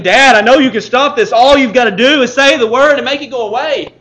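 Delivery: loud, high desperate begging; voiced child crying out to Dad; pleading child. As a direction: beg your father to make it stop. Child begging parent to stop suffering.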